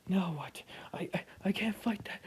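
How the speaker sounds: high, fast frightened whisper; panicked high whisper; scared whisper. Acted. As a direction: stammer it out in panic. Scared child stammers in whisper.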